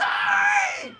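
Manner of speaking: very high, loud screamed command; furious gamer shriek; battle yell. Real, not acted. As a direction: scream it at the enemy. Attacking enemy in game.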